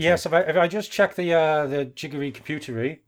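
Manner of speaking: lightly put-on posh English voice; a hint of upper-class British; mock posh British accent. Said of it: "I lost my, my posh accent".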